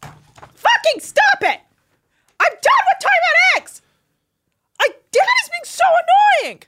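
very high, screechy daughter tantrum; hammed-up high squeal of exasperation; mock angry outburst. Imitating daughter fed up with parents over eggs, mock tantrum. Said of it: "then she just goes".

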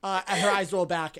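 normal talking voice

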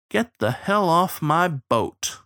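measured, firm send-off; pointed dismissal. A narrator's reading. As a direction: spell out the dismissal word by word. Captain orders starling off.

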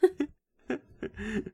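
loud laughing words, slow; cracking up at her own joke. Real, not acted.

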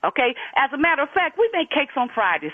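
normal talking voice

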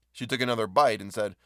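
plain reading voice

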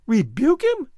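loud, high, scornful echo; indignant cry. A narrator's reading. Lolita scoffing at Diego's timid plan, loud and indignant. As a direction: throw his words back at him in disbelief. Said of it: "the girl cried".

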